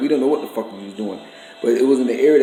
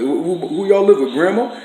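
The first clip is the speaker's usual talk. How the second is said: higher, louder mock voice of the brother-in-law; higher, louder put-on voice mocking a phony question; mocking imitation; 6 semitones higher, 4 dB louder than usual. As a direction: sneer his question back at him. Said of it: "You just was like".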